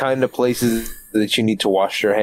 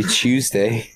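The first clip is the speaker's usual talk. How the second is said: British "chewsday" for Tuesday; mock British accent. Friend mocking how Brits say the day, British accent. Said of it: "I hate British people".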